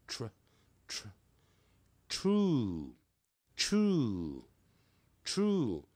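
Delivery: "tr" as one quick cluster, then "true" drawn out slowly; blended tr. Shown as a demonstration. Teacher showing students the blend, careful pronunciation. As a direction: blend "tr", then stretch "true" with a falling vowel. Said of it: "念快合在一起念".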